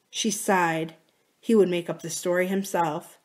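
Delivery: ordinary delivery, nothing marked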